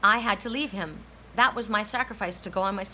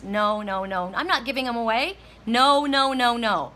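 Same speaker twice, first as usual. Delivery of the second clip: repeated no's, building to a loud, higher outburst; retold emphatic no, climbing louder; insistent refusal; 3 semitones higher, 5 dB louder than usual. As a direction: refuse again and again, louder each time. Retelling refusing to give dog away.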